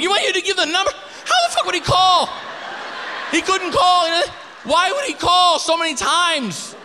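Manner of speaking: high, loud outraged scream. Retold or imitated. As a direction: scream at him, half laughing. Screaming at roommate.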